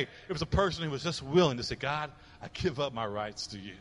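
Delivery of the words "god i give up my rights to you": low, soft, humble yielding; quiet surrender prayer. Acted. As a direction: pray it quietly, letting the voice sink. Willing person's humble prayer, voiced quietly.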